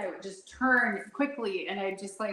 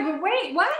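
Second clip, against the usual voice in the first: shocked; 9 semitones higher, 7 dB louder than usual. Retold or imitated. Shocked reaction, loud high.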